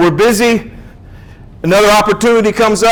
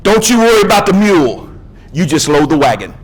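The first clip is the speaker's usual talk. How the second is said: Jay's wise words. Retold or imitated. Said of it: "this is what he says".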